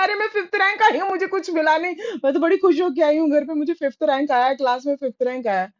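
put-on high, whiny wounded child; a child's high, aggrieved complaint; hurt child protesting. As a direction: plead your case like a stung little girl.

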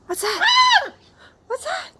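high, excited what's that. Acted. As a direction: hype up the dog with curiosity. Exciting the dog about a sound.

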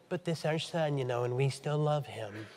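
soft, low voice of an excusing parent; hushed, mock-apologetic parent; mock pleading parent. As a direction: imitate a parent meekly making excuses. Voicing a parent excusing their child.

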